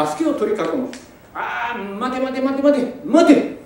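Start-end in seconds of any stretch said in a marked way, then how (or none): 1.3-3.6 commanding stop. Acted. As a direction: hold them back with authority. Lord stops the officers.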